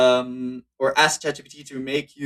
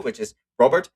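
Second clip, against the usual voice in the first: usual speaking voice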